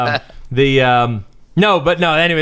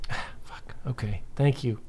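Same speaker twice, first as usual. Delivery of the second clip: much softer, breath-only climbdown; deflated half-whisper; 2 semitones lower, 14 dB quieter than usual. Retold or imitated. Dad accepting a snowboarder's apology, deflated half-whisper. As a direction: sigh it out under your breath. Retelling his deflated response.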